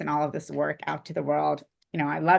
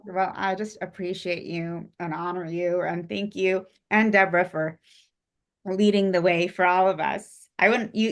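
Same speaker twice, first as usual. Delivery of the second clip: normal talking voice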